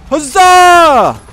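very high, loud cheer; drawn-out high-pitched holler; battle cry. Pretend. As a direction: whoop it out like a war cry.

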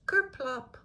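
high, loud comic sound word; comic kerplop. A narrator's reading. Mother faints in the story, comic thud word. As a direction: pop it out like a pratfall.

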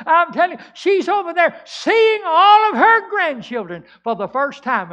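high, loud preacher's exclamation; exultant revival shout; fervent testimony. Acted. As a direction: proclaim it at full force, pitched right up. Acted testimony climax in sermon.

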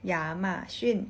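"หยาหม่าชิ่น" said as Mandarin ya-ma-xun; "หยาหม่าชิ่น" in Chinese tones; Mandarin reading. Shown as a demonstration. Teacher giving the Chinese name for Amazon, Mandarin tones. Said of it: "Amazon อ่านว่า".

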